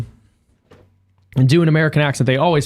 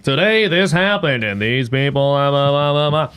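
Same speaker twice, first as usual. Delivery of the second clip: put-on old newsreader parody. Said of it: "Walter Cronkite wasn't like".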